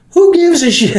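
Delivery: high, loud put-down in a put-on voice; sharp high retort; dismissive comeback. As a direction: toss it off as a brash club host. Ebony snapping back at him.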